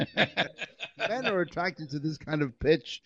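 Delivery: slow, talking through laughter; laughing. Real, not acted. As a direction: say it while cracking up. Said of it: "We said a sexy voice".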